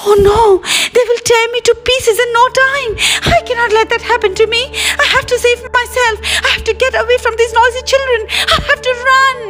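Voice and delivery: high, fast, squeaky panic; frightened little creature desperate to escape; terrified dosa. A narrator's reading. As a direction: panic in a tiny high voice, words tumbling out. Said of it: "felt terrified".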